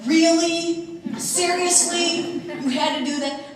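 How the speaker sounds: high, loud, drawn-out disbelief; imitated exasperation. Husband to wife after she scolded the seniors, loud incredulous reproach. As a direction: stretch it out like an appalled spouse.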